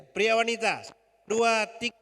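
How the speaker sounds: high, loud count-in; rally the crowd with a shouted count; loud cueing. Real, not acted. Preacher cues women and men to repeat, calling out loudly.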